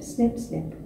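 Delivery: ordinary delivery, nothing marked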